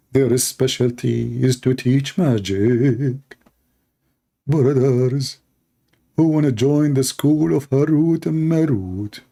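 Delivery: drawn-out chanting with stretched vowels; mock-solemn recitation. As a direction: intone it slowly like a grand holy proclamation. Mock-solemn recitation of the angels story.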